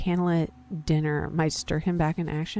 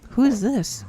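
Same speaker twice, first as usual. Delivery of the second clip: high, loud startled question; jolted by who opens the door; 6 semitones higher, 5 dB louder than usual. Real, not acted. A scary doctor opens the door, startled cry.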